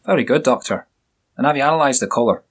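a hint of a jovial Scottish burr; Scottish accent. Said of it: "with a strong Scottish accent".

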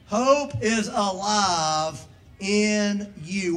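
very slow, each word weighed; slow emphatic. Shown as a demonstration. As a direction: draw "Hope is alive in you" out slowly. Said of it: "Say it with me".

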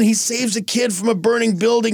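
ordinary talk, nothing marked

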